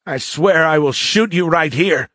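high, agitated cadet voice; agitated threat. A narrator's reading. Cadet threatens to shoot the warrior, agitated.

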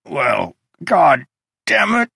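angry snarled curse. A narrator's reading. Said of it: "he snarled".

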